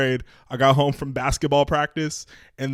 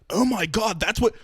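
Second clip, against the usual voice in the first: higher-pitched retold "oh my God" amazement; awed surprise; 7 semitones higher than usual. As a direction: imitate a stunned kid, voice jumping up.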